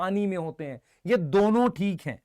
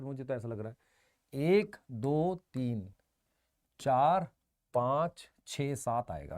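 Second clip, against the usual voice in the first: softly counting to himself, lower; muttered counting; 9 semitones lower, 9 dB quieter, slower than usual. Real, not acted. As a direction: count under your breath. Teacher counting under breath.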